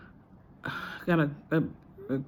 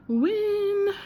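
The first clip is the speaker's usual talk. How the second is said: high, loud, held-out "win"; drawn-out celebratory exclamation; triumphant cheer; 6 dB louder than usual. Real, not acted. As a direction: sing out a little victory. Celebrates how the class went.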